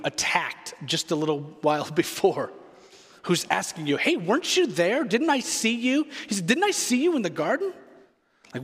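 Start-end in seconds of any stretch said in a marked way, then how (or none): 4.0-6.1 high, swooping questions, pitched well above usual; suspicious questioner. Retold or imitated. Relative confronting Peter in the courtyard, high suspicious questioning. 6.5-7.7 high, quick, swooping question; suspicious questioner. Retold or imitated. Relative asking Peter about the garden, high suspicious questioning.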